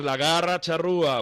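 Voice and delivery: loud high shouted chant; shouting. Retold or imitated. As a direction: bellow it like a fan. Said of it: "empieza a gritar".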